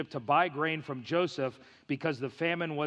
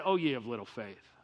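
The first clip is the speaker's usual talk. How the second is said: lower, softer fatherly rebuke; gentle God voice; 8 semitones lower, 5 dB quieter than usual. Acted. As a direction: chide softly from above. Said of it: "and he said".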